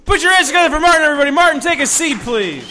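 high, loud emcee call; host's announcement. Real, not acted. Host bringing Martin off stage, high loud announcing. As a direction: call out the act to the crowd. Host introduces act to audience.

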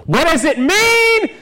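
high, drawn-out cry of bafflement; mock exasperated yell. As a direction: wail the question at the crowd in mock despair. Mock exasperated outburst at the audience.

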